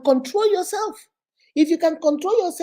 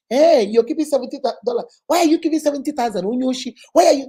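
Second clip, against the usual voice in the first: loud, rushed interrogation of a husband; acted accusatory outburst; accusing; 5 dB louder, faster than usual. Shown as a demonstration. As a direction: confront him with sharp, louder bursts. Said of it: "that's accusing him".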